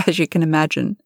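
small laugh, then a quick remark; acted little laugh leading into the words; light laugh. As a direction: chuckle into a quick aside. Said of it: "Nicoline laughed".

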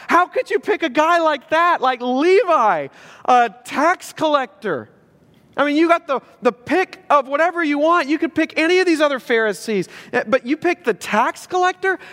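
high-pitched mock outrage at an absurd choice; incredulous objection. Onlookers questioning Jesus's pick of Levi the tax collector, incredulous.

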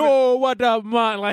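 high-pitched mock Jamaican accent. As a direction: greet in an exaggerated Jamaican voice. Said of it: "it's just Jamaican voice".

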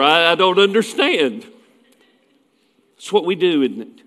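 usual speaking voice

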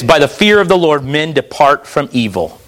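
low, solemn Bible reading; scripture reading. Acted. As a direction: read the verse gravely, dropping at phrase ends. Reads scripture aloud.

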